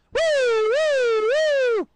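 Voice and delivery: loud, very high siren whoops; police siren imitation. Police arrive after the explosion, high whooping siren. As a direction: wail like an arriving squad car.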